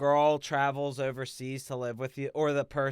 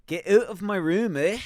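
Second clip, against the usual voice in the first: loud mock Canadian order; 5 semitones higher, 5 dB louder than usual. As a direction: bark it like a hoser. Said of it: "that's Canadian".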